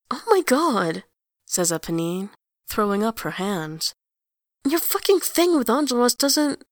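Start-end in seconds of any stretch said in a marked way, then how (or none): 0.1-1.0 loud, high exasperation; exasperated. A narrator's reading. Eponine exasperated. 4.7-6.6 loud, high angry outburst; furious. A narrator's reading.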